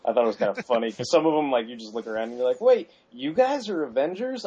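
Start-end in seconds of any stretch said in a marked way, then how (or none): 2.6-4.4 high-pitched incredulous question; mock disbelief. Reader sizing up lesser heroes as Avengers, incredulous.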